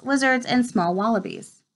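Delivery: usual speaking voice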